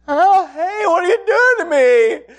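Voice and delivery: put-on high, loud startled child; imitating a shocked four-year-old; surprised little girl. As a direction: yelp it like a kid feeling the shot. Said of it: "right up until it was".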